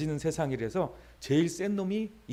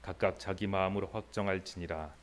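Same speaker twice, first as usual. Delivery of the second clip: slow, measured Bible reading; scripture reading; 8 semitones lower, slower than usual. Acted. Pastor reading Romans to the congregation, slow and measured. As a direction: read each verse deliberately, like from the pulpit.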